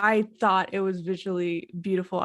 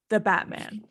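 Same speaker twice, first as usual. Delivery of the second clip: usual speaking voice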